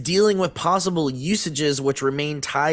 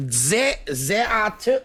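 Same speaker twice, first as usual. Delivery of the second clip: mock French accent, pitched up; 8 semitones higher than usual. Reading the news for friends in a mock French accent. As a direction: ham up a French accent on the restart. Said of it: "Can you do it in a French accent?".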